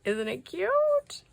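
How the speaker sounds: high, drawn-out coo over something cute; cutesy gushing. Real, not acted. Gushing over cute handmade gift.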